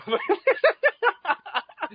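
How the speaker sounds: high-pitched laughing fit; high laughing burst. Real, not acted. Big laugh.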